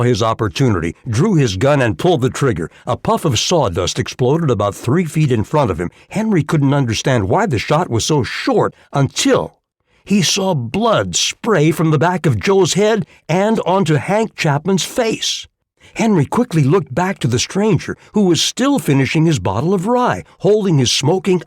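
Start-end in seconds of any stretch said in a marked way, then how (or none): none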